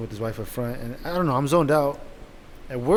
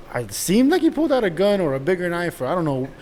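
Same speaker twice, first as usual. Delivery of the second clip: ordinary delivery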